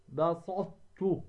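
slow "بسطت" as "basaṭ-tu", ط unreleased; merged recitation. Shown as a demonstration. Teacher models the scriptural word, merged ط. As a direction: hold the ط of بسطت, then release as ت. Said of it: "تدغم الطاء ولا تقوم بلفظها".

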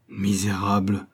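slow, strained cry of pain and rage; the count crying out in pain and fury; anguished cry. A narrator's reading. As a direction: spit it out choking with grief. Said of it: "s'écria le comte, ivre de douleur et de colère".

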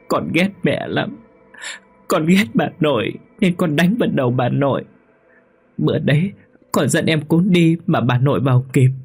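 put-on high, tearful child complaining; crying ghost child. Ghost child tells mother of cold, high and tearful. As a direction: whimper like a cold little child. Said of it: "cứ thế mà khóc mà dỗi".

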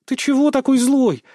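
put-on high, huffy girl's voice; pouting. A narrator's reading. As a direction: complain with a pout, pitched high. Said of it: "надулась она".

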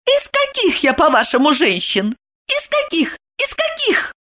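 very high insistent questioning; flaring up. A narrator's reading. Woman demanding what kind she is, incensed. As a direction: hound him with piercingly high questions. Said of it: "взвилась она".

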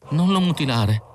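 high-pitched, pleading voice; thin, imploring plea; imploring murmur. A narrator's reading. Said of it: "mormorò lo speciale in tono implorante e con un filo di voce".

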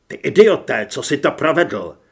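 loud, exasperated outburst; angry rebuke. Acted. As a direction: berate a friend for what he did. (rozčilená hlasitá výčitka)

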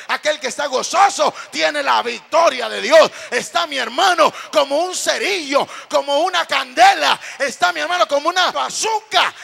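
loud, chant-like build on repeated phrases; full-force preaching. Real, not acted. Preacher telling brothers the joyful are victorious, full-force preaching. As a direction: drive each phrase home to the crowd.

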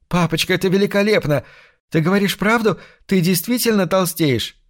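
выше, восторженно и оживлённо; задыхаясь от радости; радостное волнение. A narrator's reading. Дочь радуется новости отца. (дочь ликует по телефону, восторженно)

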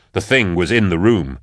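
ordinary delivery, nothing marked

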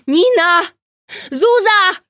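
full-voiced high yell of both names. Agata yelling for her missing friends, loud and frantic. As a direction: bellow the names in panic. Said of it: "ryknęła jeszcze głośniej".

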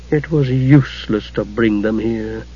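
understated bitter disgust. A narrator's reading. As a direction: say it slowly, with bitter resignation. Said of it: "Takhisis said bitterly".

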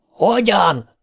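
high-pitched exasperated question; yelled back. A narrator's reading. Ben answers Beverly, yelling back. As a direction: call it back, pitched well up. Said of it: "ordított vissza Ben".

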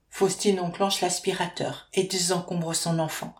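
ordinary delivery

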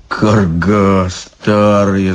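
low, slow grumble; grumbled insult. Acted. Ivan curses the old witch, grumbling. Иван бранит Бабу Ягу.

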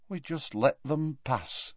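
slow, soft, hollow admission. A narrator's reading. Tonks admitting they let Snape go, hollow. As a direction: say it softly and slowly, emptied out. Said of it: "in a hollow voice".

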